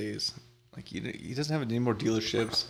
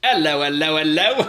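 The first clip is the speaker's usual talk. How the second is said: high, loud cockney bobby; hello hello hello like a London copper; London accent; 6 semitones higher, 11 dB louder than usual. Pretend. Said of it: "Can you do that in a London accent?".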